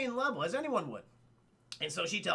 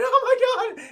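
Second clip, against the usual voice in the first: high, mock-distraught wail; mock crying; 11 dB louder, much faster than usual. Princess hears of the death, mock crying. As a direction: put on comic sobbing dismay. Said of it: "she starts crying".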